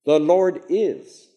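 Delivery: slow, with is lifted then falling; stress on is. Shown as a demonstration. Teacher insists it's reality, stress on is. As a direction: land firmly on is. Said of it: "It's reality".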